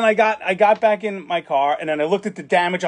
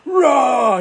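mock roar; 6 semitones higher, 4 dB louder than usual. Old man roars at him.

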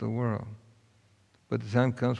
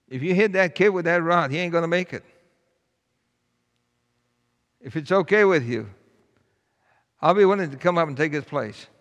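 put-on a light, higher child's plea, louder; pleading boy; 6 semitones higher, 7 dB louder than usual. A narrator's reading. Boy begs the teacher to spare his friend, higher pleading voice. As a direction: beg the teacher earnestly.

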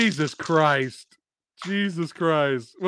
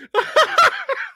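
very high shrieking laugh; real laughing fit; 5 dB louder than usual. Real, not acted. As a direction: burst out in high, helpless laughter. Cracking up at co-host's joke.

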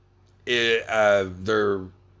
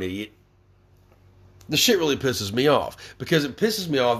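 normal talking voice

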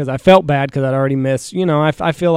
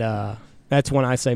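usual speaking voice

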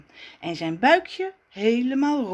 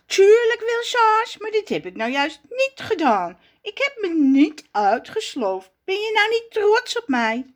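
high, eager voice hoping for praise; proud little Raf fishing for a compliment; Raf's voice; 11 semitones higher, 4 dB louder than usual. Acted. (Raf trots tegen Zaza, hoog en gretig)

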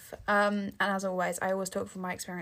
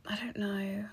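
whisper; 6 dB quieter, slower than usual. Real, not acted. Trailing off about own accent.

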